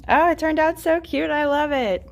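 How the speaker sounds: high, loud delight; delighted gushing. Real, not acted. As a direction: gush over how cute it is. Admiring finished drawers.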